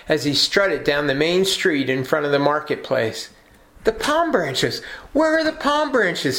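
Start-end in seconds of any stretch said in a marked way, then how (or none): 3.8-6.4 high, indignant donkey cry; a donkey demanding his parade back, shouting high; shouted. A narrator's reading. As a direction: call out for the palm branches, much higher. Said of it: "he shouted".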